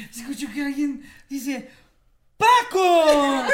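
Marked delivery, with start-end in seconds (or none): 2.4-3.4 high, loud shout of a name; calling out. Retold or imitated. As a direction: yell the name across the flat. Someone calls out across the flat.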